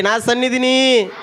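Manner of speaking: slow, high, loud shout; loud call. Shown as a demonstration. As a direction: shout it for them to repeat. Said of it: "గట్టిగా అరవండి". (గట్టిగా అరుపు)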